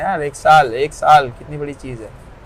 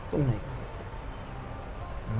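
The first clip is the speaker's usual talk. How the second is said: slow whispered taunt; 3 semitones lower, 13 dB quieter, much slower than usual. Real, not acted. Taunting challengers to match him.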